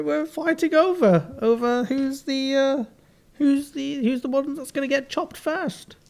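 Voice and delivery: high-pitched, lisping turkey voice. Acted. Turkey introducing the pair awaiting the chop, lisping high. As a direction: talk like a silly turkey, high and lisped. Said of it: "Why is your turkey got a lisp?".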